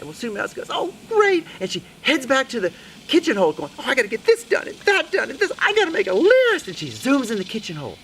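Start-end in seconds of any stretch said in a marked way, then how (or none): 0.7-1.5 very high mouse squeal; squeaky pleased mouse; delighted Sue Mouse. Acted. 3.8-6.7 high, frantic mouse muttering to herself; squeaky overwhelmed mouse lady; fretting Sue Mouse. Acted. Sue Mouse frets as she leaves.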